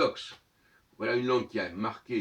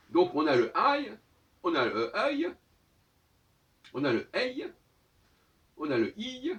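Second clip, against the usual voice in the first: each sound pronounced high and loud, one after another; emphatic listing; 10 semitones higher, 6 dB louder than usual. Shown as a demonstration. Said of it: "listing sounds emphatically".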